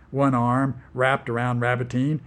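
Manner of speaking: plain reading voice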